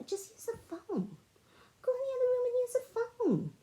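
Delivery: high, fed-up urging; exasperated insistence. Retold or imitated. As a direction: replay telling mom off in a high voice. Retold argument with her mother.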